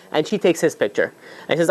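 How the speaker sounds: ordinary delivery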